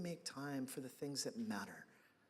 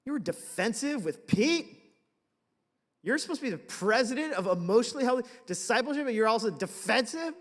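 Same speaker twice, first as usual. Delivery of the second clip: loud, high berating of himself, building with scorn; harsh self-berating; 9 semitones higher, 10 dB louder than usual. Retold or imitated. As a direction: yell at yourself like a disappointed critic.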